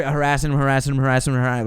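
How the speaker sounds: quick looping repetition, run together; rapid-fire harassing. Shown as a demonstration. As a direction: rattle it off without a breath between. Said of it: "like that speed".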